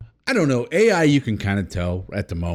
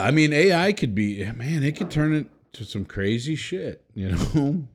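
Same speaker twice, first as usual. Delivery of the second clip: ordinary delivery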